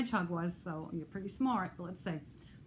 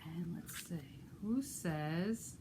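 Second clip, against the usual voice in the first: ordinary delivery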